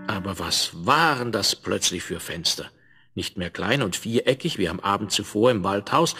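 ordinary delivery, nothing marked

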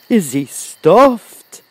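very drawn-out, high, loud astonished question; gasp, then ask in slow amazement as a lion; surprised lion. A narrator's reading. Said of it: "asked the lion in surprise".

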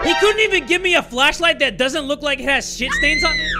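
high, loud complaint; indignant outburst. Real, not acted. Player griping about a dirty old flashlight, indignant. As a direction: blurt it out in disbelief.

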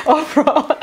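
saying the word through a real laugh. Real, not acted. Vlogger laughing at the word uproar, amused.

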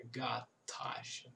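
whispered slow "Got Tasha". Shown as a demonstration. Teacher whispers "Got Tasha" slowly for students. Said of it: "whispered demo".

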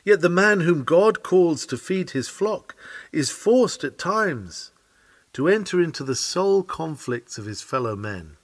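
ordinary delivery

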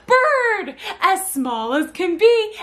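high, loud sing-song delight; really animated. Shown as a demonstration. Mother cheers babies' answer while finishing the rhyme, animated. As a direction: burst out with it, then stretch the line. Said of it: "I just get real animated".

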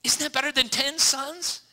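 high, loud goofy husband voice; mock clueless husband. A clueless husband scolding his sad wife, high and loud. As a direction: ham up a clueless husband pleading.

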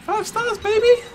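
very high, loud triumphant yelp; thrilled shout of victory; excited cheer. Real, not acted. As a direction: cheer at the top of your voice. Cheering the game's five-star rating.

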